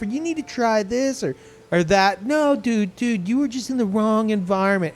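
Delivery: high, slow, dopey stoner friend. Retold or imitated. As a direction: drawl it out like a baked friend. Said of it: "You really gotta use the stoner voice?".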